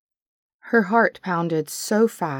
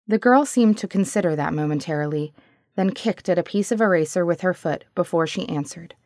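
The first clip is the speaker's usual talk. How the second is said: ordinary delivery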